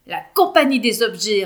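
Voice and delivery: loud proud blurt; name it on the spot, proudly; spontaneous. A narrator's reading. Yacine proposing the troupe name, loud and proud. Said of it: "répond Yacine spontanément".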